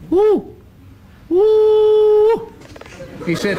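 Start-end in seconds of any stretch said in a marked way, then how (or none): 0.1-2.5 high loud whooping call; bunyip mating call. Retold or imitated. Teller demonstrates the bunyip call to the pub, loud high whoops. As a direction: whoop it out like a wild animal calling. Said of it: "you yell the mating call of the wild bunyip".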